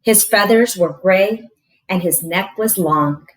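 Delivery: ordinary delivery, nothing marked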